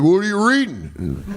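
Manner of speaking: put-on suspicious question in a thick Southern accent. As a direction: mimic a Southern boss asking. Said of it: "Southern accent. He's like".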